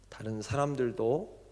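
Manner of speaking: ordinary talk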